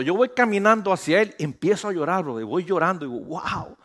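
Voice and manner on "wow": breathless hushed wow; awed whisper. Retold or imitated. Recalling the violinist, a soft awed whisper. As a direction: let the wow slip out in a whisper.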